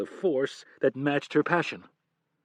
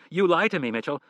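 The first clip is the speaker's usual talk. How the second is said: furious; 7 semitones higher, 7 dB louder than usual. Acted. Wife's furious accusation.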